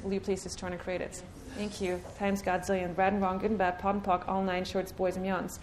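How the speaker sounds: quick, hushed ritual recitation; softly rushed chanted formula; rattled-off clearing statement. Acted. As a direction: murmur the formula fast like a rote incantation.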